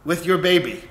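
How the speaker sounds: retold blurted confession; blurting out. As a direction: burst out with it before he leaves. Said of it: "Helga blurts out".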